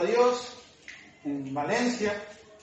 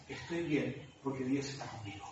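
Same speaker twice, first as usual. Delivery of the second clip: soft, hushed imitation of a suffering mother; a hushed, peaceful imitation of a mother in pain; mother's quiet faith; 7 semitones lower, 10 dB quieter than usual. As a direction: say it barely above a breath, at peace. Quoting his suffering mother.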